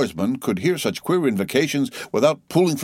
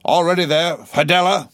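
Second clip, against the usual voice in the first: high, loud call across the deck; Ahab crying out; 9 semitones higher, 4 dB louder than usual. A narrator's reading. Captain calling to his boatman, loud and high. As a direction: hail the crew with a captain's cry. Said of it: "Ahab cried out".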